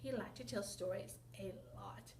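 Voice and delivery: hushed unvoiced whisper. Teacher whispers the line to her listeners. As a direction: whisper it like a secret.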